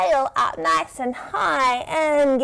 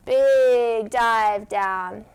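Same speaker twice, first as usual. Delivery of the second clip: very slow, drawn-out dive down; slow big dive; 2 semitones lower, much slower than usual. Acted. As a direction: stretch every word of the dive. Diving back down.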